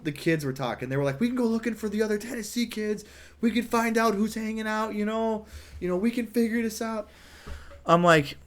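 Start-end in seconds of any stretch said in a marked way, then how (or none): 1.2-7.1 put-on kids' voices. Kids voiced, planning.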